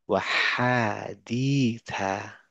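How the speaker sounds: হাদিথা with a th sound for থা; Arabic reading. Shown as a demonstration. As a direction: model the ث in হাদিথা. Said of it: "এটা থা".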